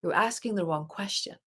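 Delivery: a calm hint of a female guide's voice; guide's quiet voice. Inner guide answering her Googling, quietly. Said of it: "it's always this female voice".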